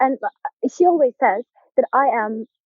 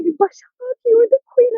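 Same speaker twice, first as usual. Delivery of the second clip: high, loud shout of the news; excited yell; 14 semitones higher, 4 dB louder than usual. Retold or imitated. Friends shout to her that she's homecoming queen. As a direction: friends shrieking that she won. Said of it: "looking at me like".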